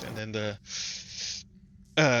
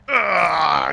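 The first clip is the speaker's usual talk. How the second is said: loud, higher acted cry of pain; 4 semitones higher, 5 dB louder than usual. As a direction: cry out as if struck by a blade. Stabbed goblin cries out.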